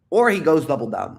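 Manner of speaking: ordinary talk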